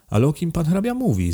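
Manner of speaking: high-pitched puzzled question; puzzled, pitch lifting; surprised. A narrator's reading. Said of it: "zdziwił się Dyzma".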